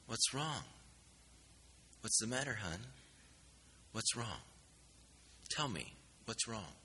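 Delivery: soft, gentle comforting questions; calm a sobbing girl down softly; soothing. Retold or imitated. Man comforts a sobbing girl in the hall, softly soothing. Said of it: "tried to calm her down".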